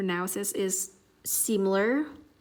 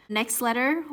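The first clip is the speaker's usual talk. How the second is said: ordinary talk, nothing marked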